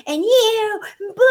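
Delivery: high, shrill mock screech; shrill screaming imitation. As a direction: mimic someone worked up and squawking. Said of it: "They're screaming in a shrill voice".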